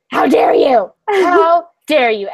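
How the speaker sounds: loud, slowing indignant shout; loud, drawn-out scolding with a laugh; mock outrage. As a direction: re-enact a scandalized telling-off. Replays her outraged yelling.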